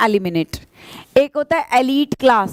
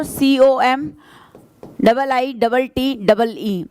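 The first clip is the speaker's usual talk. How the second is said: slow spelling; much slower than usual. Shown as a demonstration. Teacher dictating the letters of committee. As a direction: spell each pair out slowly. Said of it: "अगर committee की spelling पूछ ले तो देखो".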